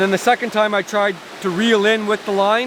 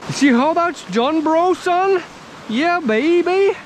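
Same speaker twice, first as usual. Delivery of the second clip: high, pumped-up gloating; excited bragging; 11 semitones higher, slower than usual. Real, not acted. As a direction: crow about the catch. Boasting after catch.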